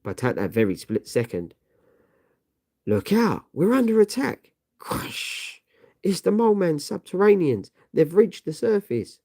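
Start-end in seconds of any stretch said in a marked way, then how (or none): 2.9-8.4 alarmed shout. Acted. Alarm at sudden attack.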